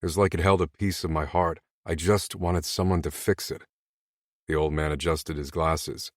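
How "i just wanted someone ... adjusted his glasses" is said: ordinary delivery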